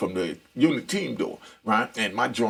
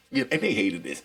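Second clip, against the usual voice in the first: ordinary delivery, nothing marked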